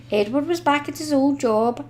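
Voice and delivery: ordinary delivery, nothing marked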